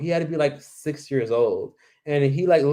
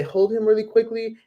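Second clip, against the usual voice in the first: high sweet request, imitated; scam mother; 8 semitones higher than usual. Stranger handing a tourist her baby, high and sweet. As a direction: hand the baby over sweetly. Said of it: "and say".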